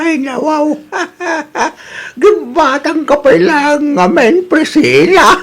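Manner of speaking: laughing. Acted. Old husband laughing with wife.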